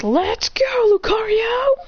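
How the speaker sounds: high, rallying shout; battle cry. Acted. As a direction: cry out high to your partner.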